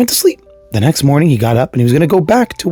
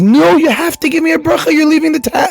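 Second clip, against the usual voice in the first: high-pitched begging lady; acted desperate demand; insistent pleading; 13 semitones higher than usual. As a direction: beg urgently and refuse to give up, voice pitched high. Lady begs as he leaves.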